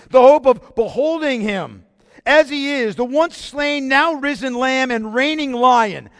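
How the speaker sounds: preaching at full force; rising fervor. Real, not acted. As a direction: lift it like a proclamation. Preacher builds to climax.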